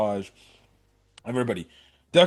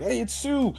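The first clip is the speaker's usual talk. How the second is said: mock sibling voice, pitched up high; siblings cheering; 10 semitones higher, 3 dB quieter than usual. Siblings cheering Sue on, high mock voice. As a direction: lightly voice the siblings, high and eager. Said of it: "her siblings are like".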